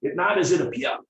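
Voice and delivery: clipped broken-English mentor line; lightly voiced Mister Miyagi. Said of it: "And Mister Miyagi said".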